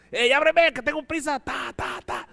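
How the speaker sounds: high, loud call through a door; impatient call. Retold or imitated. Roommate bangs on the door, calling loudly and high. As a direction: yell to be let in, in a hurry.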